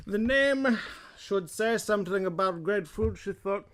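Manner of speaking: lightly slurred British reading voice; drunk British guy. Acted. Reading the kids' book as a drunk British guy. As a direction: read it like a tipsy British bloke. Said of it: "this is my drunk kind of British guy".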